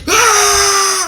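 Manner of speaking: sudden high roar of rage; berserker yell. Retold or imitated. Teen snapping at stepdad, berserker yell. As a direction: let out a wild berserker scream. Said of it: "I went straight berserker".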